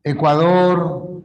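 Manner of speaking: ordinary delivery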